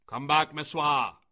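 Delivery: loud commanding call; crowd shouting. A narrator's reading. Crowd calling Meswa away from Mowgli, shouting. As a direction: shout her back sharply. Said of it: "shouted the crowd".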